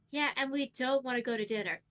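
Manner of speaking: usual speaking voice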